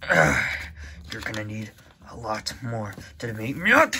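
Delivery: ordinary talk, nothing marked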